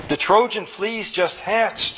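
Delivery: high, slow excited announcement; shouted. A narrator's reading. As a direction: call out the triumphant news. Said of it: "shouted Ond".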